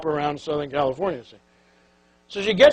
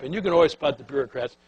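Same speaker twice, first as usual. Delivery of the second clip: ordinary delivery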